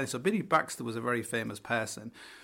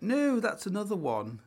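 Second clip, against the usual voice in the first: posh host; 8 semitones higher than usual. Retold or imitated. Host corrects him.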